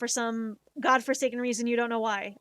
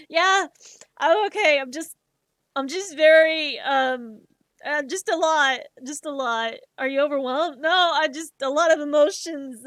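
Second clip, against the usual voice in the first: overwhelmed reply; 4 semitones higher, 8 dB louder than usual. Retold or imitated. Answering stranger while overwhelmed.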